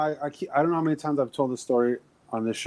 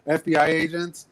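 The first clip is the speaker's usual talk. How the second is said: ordinary talk, nothing marked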